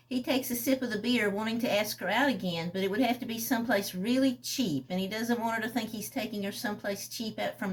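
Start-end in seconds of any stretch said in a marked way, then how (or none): none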